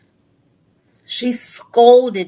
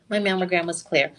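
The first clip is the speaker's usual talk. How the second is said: normal talking voice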